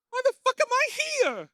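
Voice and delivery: put-on high, indignant old lady; Eve's outraged voice. Old Eve asking Margaret why she came, high and indignant. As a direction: squeal it like a baffled old lady. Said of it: "And Eve's like".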